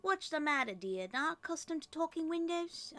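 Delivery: high, lilting put-on accent; Merryweather's put-on accent. Good fairy greets a startling visitor at the window, affected accent. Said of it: "why I gave Merryweather that talk, that accent".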